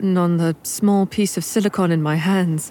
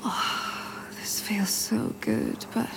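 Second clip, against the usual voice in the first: breathy whisper; 4 semitones higher, 9 dB quieter, slower than usual. Acted. Aroused moan to husband.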